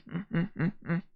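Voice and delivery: ordinary talk, nothing marked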